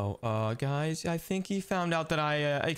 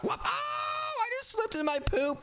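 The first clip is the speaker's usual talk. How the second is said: high yelp of surprise; startled yelp. Real, not acted. Player slips in his own poop, yelping. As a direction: yelp as you slip.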